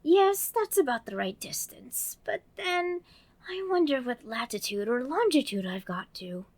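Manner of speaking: put-on high, bright little girl voice; Alice voice. Narrator voicing Alice, girlish and eager. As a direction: play young Alice talking to herself.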